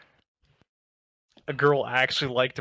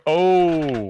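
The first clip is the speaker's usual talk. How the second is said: a sharp, loud, higher "oh!" of sudden recall; sudden surprise; 5 semitones higher, 7 dB louder than usual. Real, not acted. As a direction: blurt it out as it suddenly hits you. Suddenly remembers mid-sentence.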